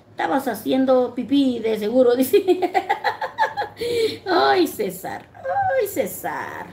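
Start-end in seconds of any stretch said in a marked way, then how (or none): 4.2-6.7 laughing at César. Real, not acted. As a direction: laugh his name out in disbelief.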